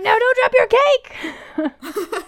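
high, loud, drawn-out mock yell; hammed-up panicked plea to the TV; yelling at screen. Viewer yelling at baking contestants, hammed-up shout. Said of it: "Do you yell at the screen?".

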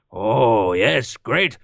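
put-on game panda character voice; Pandaren voice. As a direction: do a hammed-up, drawn-out Pandaren. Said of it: "I'm allowed to do a Pandaran's voice".

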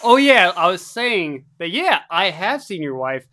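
usual speaking voice

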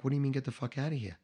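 quick, hushed disbelief; stunned. Retold or imitated. Man answers the hurt boss who dismissed him, softly stunned. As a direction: ask it softly, taken aback. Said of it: "And I was stunned".

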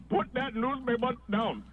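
lightly voiced newsstand guy, slow; a hint of a gruff street vendor; mild newsstand vendor voice. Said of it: "some guy that talks like this".

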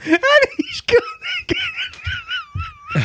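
high squeaky laughter over words; crying with laughter; laughing. Real, not acted. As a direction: giggle out the words. Laughing at Bagel dropping out.

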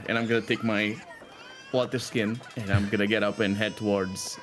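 normal talking voice

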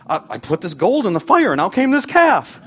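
mock-innocent excuse, rising high at the end; sheepish excuse. Aaron caught out, making a lame excuse to Moses, mock-innocent.

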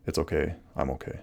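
soft, calm "It's okay. I'm okay."; soothing mantra. Shown as a demonstration. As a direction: quietly reassure yourself. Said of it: "throw in three more".

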